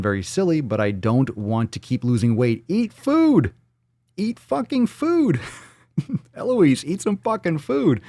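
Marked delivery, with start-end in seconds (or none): 2.7-8.0 high-pitched comic pleading yell; exasperated outburst. Real, not acted. Host urging an undereating listener, in exasperated high cries. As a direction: wail it high, drawing out the last word. Mock outrage at listener's tiny intake.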